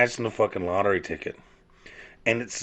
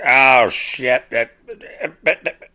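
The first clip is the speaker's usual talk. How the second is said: loud, higher sputtering mock outburst; dad ranting at TV; 5 semitones higher, 7 dB louder, slower than usual. Dad cussing at the TV commercial, loud mock rage. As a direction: play a dad losing it at a commercial. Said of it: "my dad would sit there and be like".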